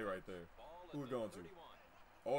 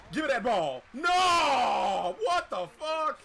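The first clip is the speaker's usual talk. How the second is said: loud, high yelling at the play; angry shout; 8 semitones higher, 10 dB louder, slower than usual. Real, not acted. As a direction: yell out in real frustration. Opponent picks off the ball.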